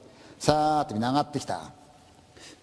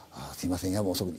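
softer, lower, hesitant apology; quiet apologetic hostess; 8 semitones lower, 6 dB quieter than usual. Acted. Inn mistress wakes the guest at night, soft and apologetic. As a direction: apologise softly for the late hour.

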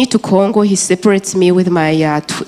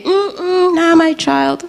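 high, pitched-up dismissive denial; imagined woman rejecting her, voiced higher; 12 semitones higher, much slower than usual. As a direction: shake it off like a sudden refusal. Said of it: "if something changed in her and say".

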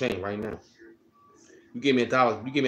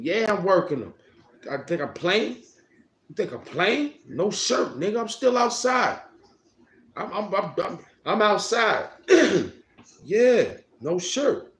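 much higher, loud hype yelling; hyped shouting; 10 semitones higher, 5 dB louder than usual. Real, not acted. Streaming shirtless outside, yelling hyped at the chat. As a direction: yell it out, fully amped.

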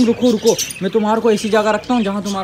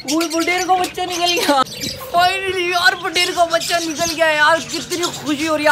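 real joy bursting out at a hatched chick; thrilled surprise; 9 semitones higher than usual. Real, not acted. Vlogger spots newly hatched quail chick, cries out in delight.

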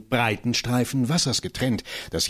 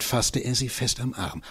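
ordinary delivery, nothing marked

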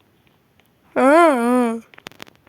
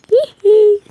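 high giggle; 9 semitones higher, 8 dB louder than usual. Real, not acted. Giggle while gaming.

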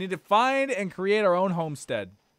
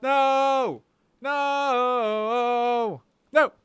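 loud high repeated no; shouting denial at the game; protesting shout; 8 semitones higher, 6 dB louder, much slower than usual. Real, not acted. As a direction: yell it in protest.

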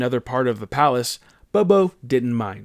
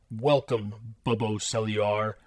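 lowered, slow, resonant brain voice; deep telepathic voice; 5 semitones lower, 7 dB quieter, slower than usual. Acted. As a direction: voice the ancient brain, deep and calm. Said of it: "The deep, cheerful voice resonated".

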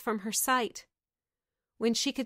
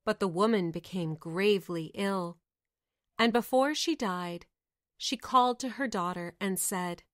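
plain reading voice

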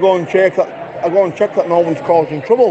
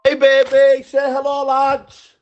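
put-on pitched up, boozy hello to the girlfriend; tipsy high greeting; 9 semitones higher, slower than usual. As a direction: call out to her with a drink in hand. Drunk calling out to girlfriend.

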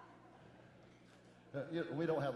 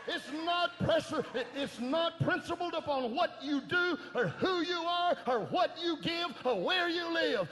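slow, high, shouted pulpit climax; whooping preacher's peak over the organ; full-force preaching; 12 semitones higher, 12 dB louder, slower than usual. Acted. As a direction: hammer each word out as loud as you can.

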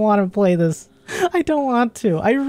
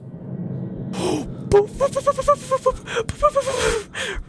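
sudden frightened gasp; sharp scared gasp; 4 dB quieter than usual. Real, not acted. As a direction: gasp in shock at a jump scare. Jump scare in horror game.

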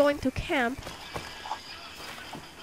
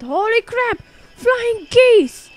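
loud, higher cry of alarm; startled alarm; 4 semitones higher, 10 dB louder, slower than usual. Pretend. Player startled by flying geese, alarmed. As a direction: yelp at a sudden threat. Sudden geese attack in game.

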